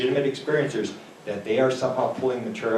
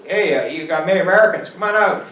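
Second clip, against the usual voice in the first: calling out; 7 semitones higher, 7 dB louder than usual. Retold or imitated. Prospector calling into the craft.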